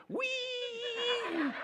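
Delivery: very high squealing pig cry. Acted. Preacher imagining pigs leaping off the cliff, high squeal.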